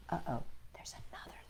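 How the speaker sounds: fast hushed whisper. Acted. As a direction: lean in and whisper it like a secret. Spooky hint of another letter.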